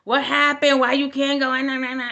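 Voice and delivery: loud, high, demanding questions; retold mom hollering across the yard. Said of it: "my mom starts hollering".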